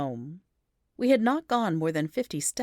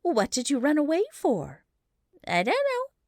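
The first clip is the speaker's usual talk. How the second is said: put-on child voice; 10 semitones higher, 3 dB louder than usual. A narrator's reading. Questioning lost child.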